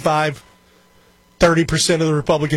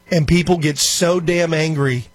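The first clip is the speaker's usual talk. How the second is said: ordinary delivery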